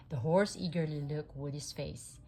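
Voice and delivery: ordinary delivery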